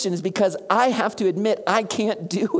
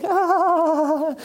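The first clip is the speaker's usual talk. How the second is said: high, louder hide-and-seek "ah"; playful hiding gasp; 10 semitones higher, 4 dB louder than usual. Retold or imitated. Jesus hiding behind the couch, high playful gasp. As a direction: squeak it out like a kid behind the couch. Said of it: "behind the couch going".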